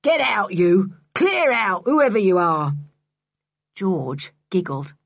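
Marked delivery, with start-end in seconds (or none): 0.0-2.8 loud angry shout out the door. A narrator's reading. Mister Stick at the door shouts into the dark dungeons, loudly. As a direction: bellow them away. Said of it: "shouted loudly".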